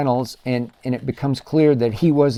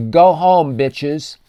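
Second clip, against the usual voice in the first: a loud, raised-voice jab at the fans; shouted taunt; 3 semitones higher, 5 dB louder, much slower than usual. Retold or imitated. Quoting Luka taunting Timberwolves fans, shouted taunt. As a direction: bark it at the crowd, mocking. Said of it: "he says, and I quote".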